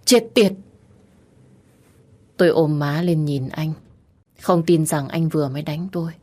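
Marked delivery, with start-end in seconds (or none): 0.0-0.5 higher, louder furious curse; angry curse. A narrator's reading. Husband cursing after striking wife, furious shout. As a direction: spit out the curse in rage.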